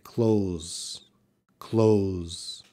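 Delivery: "close" as clothes, with a little TH before the Z; slight TH. Shown as a demonstration. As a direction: let the tongue lightly touch a TH in "close". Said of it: "a little bit of a T H sound".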